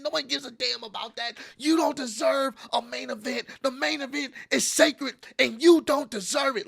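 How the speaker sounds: high, strained mock yell of a fuming rival; hammed-up seething shout; furious rant. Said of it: "pissed the fuck off".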